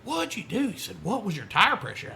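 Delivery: high, pressing retold questions; imitating excited Eric. Eric asking about the run, imitated excitement. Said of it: "Eric's so excited".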